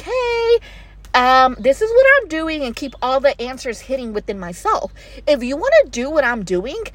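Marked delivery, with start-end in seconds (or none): 0.0-2.6 mock show-off. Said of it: "I'm not just gonna be like".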